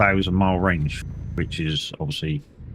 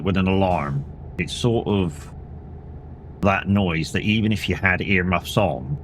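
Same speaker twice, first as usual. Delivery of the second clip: ordinary delivery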